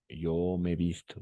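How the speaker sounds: usual speaking voice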